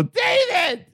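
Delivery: very high laughing shout of the name; excited greeting. Retold or imitated. Answering David's call with a delighted laughing yell. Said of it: "I go".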